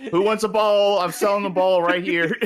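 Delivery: loud laughing street vendor; laughing hawker call. Pretend. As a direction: hawk the ball through laughter. Selling the ball, laughing.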